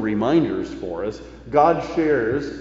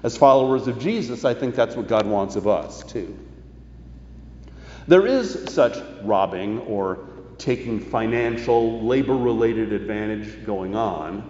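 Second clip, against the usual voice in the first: ordinary delivery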